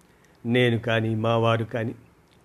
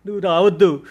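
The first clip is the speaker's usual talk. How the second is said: much higher, louder panicked begging; frightened pleading; 8 semitones higher, 7 dB louder than usual. Acted. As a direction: beg her not to go. Daughter frightened, begs mother not.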